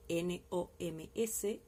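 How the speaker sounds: letter by letter, slow; teacher spelling each letter; spelled slowly. Shown as a demonstration. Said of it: "es apellidos en plural".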